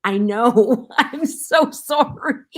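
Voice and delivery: sheepish apology through laughter, loud, slow and high; retelling an embarrassed, giggling apology; laughing apology. Retold or imitated. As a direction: laugh out a mock-guilty sorry to the producers. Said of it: "And I went".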